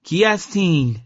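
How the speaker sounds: high-pitched call into the valley; shouting down. A narrator's reading. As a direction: call out from the edge above. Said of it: "از بالای دره داد زد".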